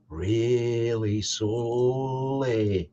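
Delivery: words drawn out long and slow; stretched slow speech. Shown as a demonstration. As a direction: drag the vowels right out. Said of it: "He stretched the voice".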